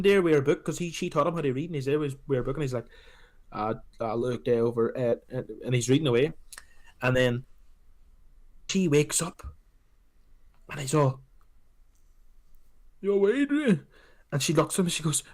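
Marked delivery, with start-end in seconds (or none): 3.5-5.2 slow, slurred Rocky impression; imitating Rocky. Retelling the film, doing Rocky reading to his wife, slow mock mumble. 13.0-13.8 higher mock Rocky greeting; Rocky impression. Rocky greeting Adrian as she wakes, hammed-up impression.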